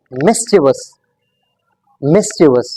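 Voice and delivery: "mischievous" in three beats, MIS-chi-vus; careful "mischievous" with short middle vowel; correct pronunciation. Shown as a demonstration. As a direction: model "mischievous" stressed on the first syllable, falling. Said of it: "This word is pronounced as".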